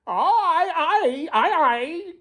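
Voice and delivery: high squawking parrot cry; the parrot calls out the next rascal, squawking. Acted. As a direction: screech it like a parrot. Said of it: "cried the parrot".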